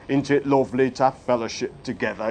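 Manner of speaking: low, hurried mock small talk; glib churchgoer. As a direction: imitate casual chat, low and quick. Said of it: "we use it very glibly".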